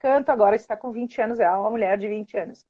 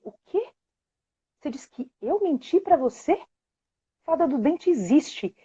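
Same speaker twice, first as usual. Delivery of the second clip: hushed protest; 4 semitones higher, 6 dB quieter than usual. Retold or imitated. Mother defends fairy calmly.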